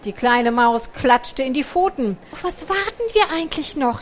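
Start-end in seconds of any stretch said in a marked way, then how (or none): 2.3-4.0 higher, impatient eager call; can't wait any longer. A narrator's reading. The impatient little mouse calling out to Picandu, eager. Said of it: "rief sie".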